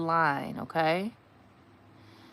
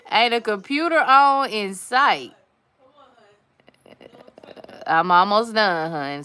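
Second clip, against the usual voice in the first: loud, high and laughing; calling out; 4 semitones higher, 11 dB louder than usual. Real, not acted. Woman shouting back to her partner that she's almost done. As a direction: shout it back across the room.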